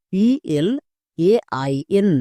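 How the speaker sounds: each letter of পিএলএআইএন drawn out for the class; slow letter spelling. Shown as a demonstration. Teacher spelling পিএলএআইএন very slowly for students. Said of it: "এবার একসঙ্গে উচ্চারণ করো".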